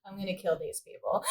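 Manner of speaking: very soft, low deadpan threat; imitated emotionless threat. Leia after losing her people, quietly deadpan. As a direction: drop right down and state it without emotion. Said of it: "it's no emotion".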